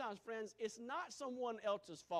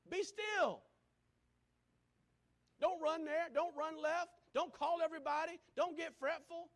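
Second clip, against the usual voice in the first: higher, louder commands; urgent exhortation; 7 semitones higher, 5 dB louder than usual. Real, not acted. Preacher urging listeners not to panic. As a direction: press each command home. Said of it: "preacher urging congregation".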